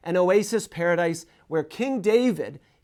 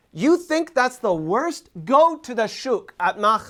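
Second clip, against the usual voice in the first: ordinary talk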